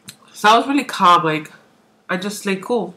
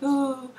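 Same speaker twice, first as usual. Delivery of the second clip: soft, high, drawn-out "ooh"; blissful ooh; 5 semitones higher, 9 dB quieter than usual. Retold or imitated. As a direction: coo softly as if pleasantly high. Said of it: "I felt really good".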